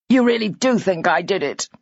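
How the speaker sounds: ordinary delivery, nothing marked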